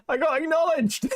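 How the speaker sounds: high, laughing triumph; real delighted laugh-talk; giddy disbelief. Real, not acted. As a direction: squeal it out with glee. Thrilled to be tweeted about.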